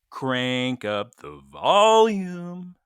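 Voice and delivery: crank it out very slowly, stretching each word; slow, stretched mock command; playfully drawn out. Host echoing co-host's volume request, slow and drawn out.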